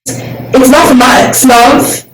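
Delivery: slow, loud hammed-up British shout; shouted mock Cockney. Student hamming up a Cockney line for the video, loud slow shout. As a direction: bellow it like a cheeky London bloke.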